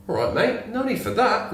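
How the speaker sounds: high voiced rabbit asking for carrots; talking rabbit. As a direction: pipe up high like a tiny rabbit. Joke, rabbit replies to threat.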